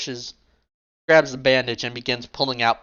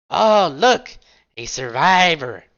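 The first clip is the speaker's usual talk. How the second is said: mock cheer, much higher and louder on look; sarcastic; 5 semitones higher, 5 dB louder, slower than usual. Rook spotting the survivor, sarcastic mock cheer. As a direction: fake delight over a wounded enemy. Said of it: "Rook says sarcastically".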